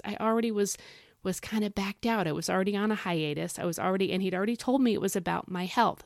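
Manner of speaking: ordinary talk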